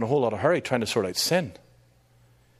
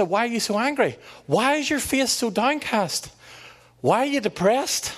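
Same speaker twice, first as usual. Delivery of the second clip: much higher, earnest questioning as God; pleading God voice; 10 semitones higher than usual. Acted. God pressing Cain on his anger in a much higher pleading voice. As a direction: lift it high and plead like God asking. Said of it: "God said".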